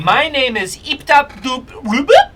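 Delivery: loud little frog creature; a hint of a tiny frog man. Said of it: "And he goes".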